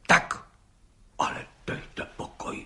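breathless whispered amazement; astonished whisper. A narrator's reading. Judge stunned by the policeman's claim, whispering. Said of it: "užasl pan soudce".

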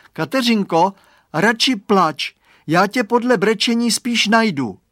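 high, loud call through the fog. Acted. Boy calls to lost friend in fog.

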